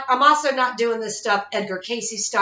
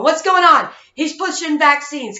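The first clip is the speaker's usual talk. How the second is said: higher, louder mock alarm; sarcastic imitation of alarmed people, higher and louder; mocking panicked people; 6 semitones higher, 6 dB louder than usual. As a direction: ham up the worried crowd crying out. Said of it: "As I'm hearing you people going".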